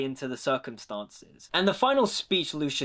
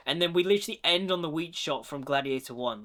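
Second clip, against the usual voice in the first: normal talking voice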